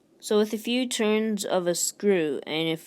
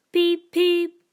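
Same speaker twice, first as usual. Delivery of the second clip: high, loud whistle call; high, loud train-whistle peeps; engine whistle; 11 semitones higher, 6 dB louder than usual. Acted. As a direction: peep like a little engine. Thomas whistles goodbye.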